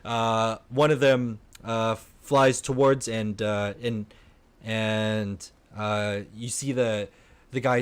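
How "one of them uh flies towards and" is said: ordinary delivery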